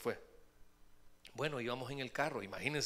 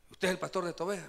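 mock stern cop; 4 semitones higher, 6 dB louder, much faster than usual. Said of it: "me dijo".

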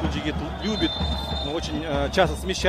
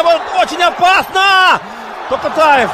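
alarmed call; 10 semitones higher, 8 dB louder than usual. Real, not acted. Danger in front of goal.

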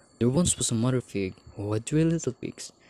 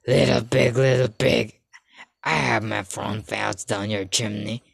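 threatening wolf; 2 semitones lower, 4 dB louder than usual. A narrator's reading. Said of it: "threatened the big bad wolf".

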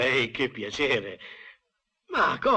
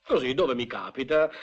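ordinary delivery

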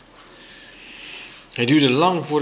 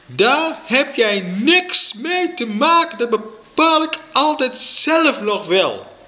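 high, slow, angry yelling; schreeuwende moeder; 13 semitones higher, 4 dB louder, slower than usual. A narrator's reading. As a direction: shout it as a mother mid-quarrel. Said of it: "hoorde ik mijn moeder schreeuwen". (schreeuwend, boze moeder)

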